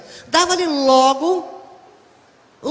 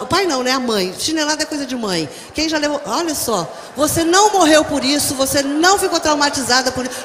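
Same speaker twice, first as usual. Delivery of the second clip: ordinary delivery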